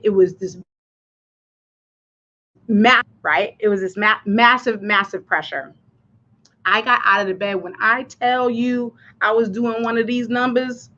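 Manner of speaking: usual speaking voice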